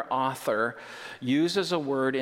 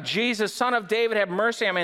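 higher, louder cry for mercy; 9 semitones higher, 4 dB louder, faster than usual. Acted. Blind man cries out to the passing Jesus, raised and higher. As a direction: call out to Jesus. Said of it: "So he cried out".

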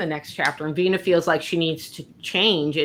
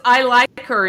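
higher, louder eager I like her; delighted approval; 6 semitones higher, 6 dB louder than usual. Retold or imitated. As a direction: gush it out with glee. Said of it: "And she also says".

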